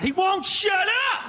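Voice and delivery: high, slow furious shout; angry yell at TV. Retold or imitated. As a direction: yell at the screen in a rage. Angry fan yelling at TV.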